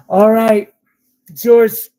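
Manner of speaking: loud, excited claim. Real, not acted. Seller closing the sale to a viewer, loud and excited. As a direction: announce the sale with a burst of excitement.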